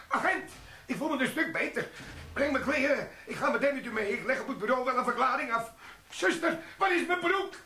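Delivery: spring up eager to go, then call for the nurse; sudden eager bluster. Acted. Sergeant suddenly keen to leave, shouting for the nurse.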